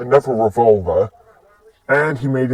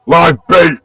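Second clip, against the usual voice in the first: loud, higher-pitched announcement; gleeful call-out; 6 semitones higher, 10 dB louder than usual. Acted. He launches her into the sky, loud high call. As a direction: shout it like a battle call.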